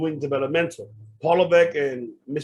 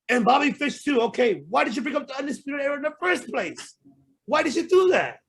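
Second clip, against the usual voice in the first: much higher, pleading-angry questions; exasperated rant; 9 semitones higher than usual. Real, not acted. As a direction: demand an answer, voice climbing. Fan rant demanding answers.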